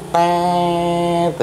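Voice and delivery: long, high held "teeet"; drawn-out high mechanical tone; imitated machine buzz. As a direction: mimic an automatic pump droning on. Imitating the water pump switching on.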